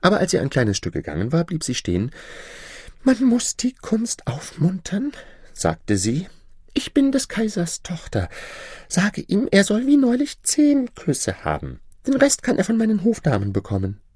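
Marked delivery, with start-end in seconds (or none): 3.0-14.0 hohe, gezierte Prinzessinnenstimme; Prinzessin. Acted. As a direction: spiel die verwöhnte Prinzessin mit hoher Stimme. Princess voice. (hochgestellte, affektierte Prinzessin)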